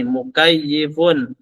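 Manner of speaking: "Mukayyifun" said slowly, syllable by syllable; slow, careful teacher's model pronunciation; slow model word. Shown as a demonstration. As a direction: draw the word out slowly for students. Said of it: "Seperti ini".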